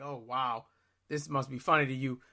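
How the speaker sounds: deadpan sarcasm. Retold or imitated. Caller drily scolds the laughing operator. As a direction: drily call out her laughter.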